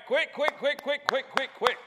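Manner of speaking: high, loud rapid-fire repetition; urgent hurrying. Real, not acted. Preacher rushing helpers with handouts, urgently hurrying. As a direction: chivvy them along in quick bursts.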